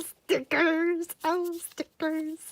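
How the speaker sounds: high, drawn-out coo; delighted cooing. Real, not acted. Fan finding stickers in the package, delighted cooing. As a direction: stretch it out slowly and high, delighted.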